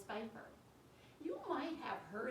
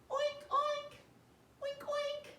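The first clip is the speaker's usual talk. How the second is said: very high, louder oink oink; pig oinks; 6 dB louder, slower than usual. Shown as a demonstration. Teacher showing the pig sound, high oinks. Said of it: "make the sound that pigs make".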